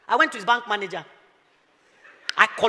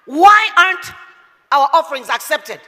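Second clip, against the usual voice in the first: preaching; 4 semitones higher, 6 dB louder, slower than usual. Acted. Preaching at full force.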